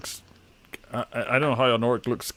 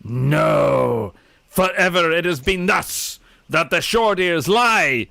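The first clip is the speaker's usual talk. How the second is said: a lightly voiced stern orc shaman; orc shaman refusal; 3 semitones higher, slower than usual. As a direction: shout it down as the old shaman. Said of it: "shakes his head and goes".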